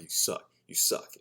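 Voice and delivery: hissed whisper to himself; whispered self-taunt. Retold or imitated. Taunting himself on the court, whispered. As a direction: whisper it at yourself.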